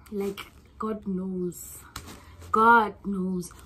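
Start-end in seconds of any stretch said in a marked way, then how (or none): none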